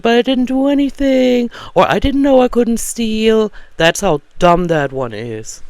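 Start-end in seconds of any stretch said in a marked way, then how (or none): 0.0-1.5 high, pleading caught-thief voice; whiny shoplifter. Retold or imitated. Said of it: "they, they said". 1.9-3.5 high, pleading caught-thief voice; whiny shoplifter. Retold or imitated. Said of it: "they, they said".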